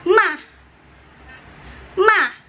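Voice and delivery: ma sharply high then falling; fourth tone, falling. Shown as a demonstration. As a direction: snap ma down from high. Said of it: "jadinya dibacanya". (nada keempat, turun tajam)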